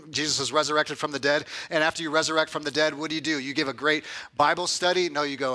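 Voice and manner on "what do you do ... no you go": normal talking voice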